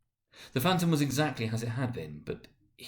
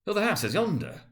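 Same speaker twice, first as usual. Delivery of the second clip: higher, startled exclamation; exclaiming; 9 semitones higher, 4 dB louder than usual. Acted. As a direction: blurt it out in surprise. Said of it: "Scrooge exclaimed".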